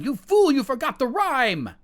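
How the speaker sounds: high, loud scolding cry; mother scolding her son as the king calls guards; mother cries out. Acted. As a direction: yell at the son for forgetting. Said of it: "she cries out to her son".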